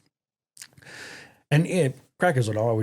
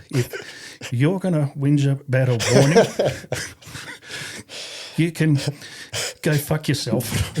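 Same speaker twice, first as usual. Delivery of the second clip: can barely get the words out for laughing; laughing through it; 3 semitones higher, slower than usual. Real, not acted. Corporal laughing as he passes the rude message to the RSM.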